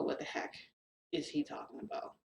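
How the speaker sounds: voiceless whisper to herself; hushed puzzled whisper; whispered thought. Retold or imitated. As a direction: mutter the thought under your breath.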